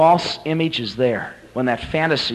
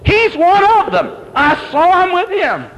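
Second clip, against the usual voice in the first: very high, loud squeal of accusation; squeaky little girl; 6 dB louder, slower than usual. Acted. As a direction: tell on him in a piercingly high little voice. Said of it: "She goes over to a big burly guard".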